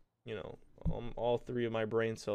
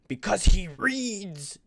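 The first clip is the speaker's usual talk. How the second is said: loud, high, drawn-out furious shout; mad teacher yell; 10 semitones higher, 10 dB louder, much slower than usual. Retold or imitated. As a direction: yell it like an angry teacher, stretching the words. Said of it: "my teacher got really mad".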